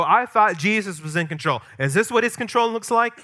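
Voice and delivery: high, loud doubter's question; rising, indignant mock disbelief; acted incredulous challenge. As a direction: imitate people demanding an answer. Voices people questioning Jesus' control.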